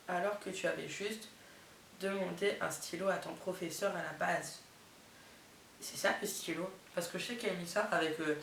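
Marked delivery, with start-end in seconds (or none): none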